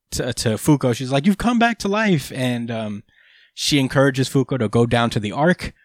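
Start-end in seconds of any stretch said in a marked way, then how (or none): 1.3-2.3 mock shout, pitched way up; retold shout. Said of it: "she starts shouting to".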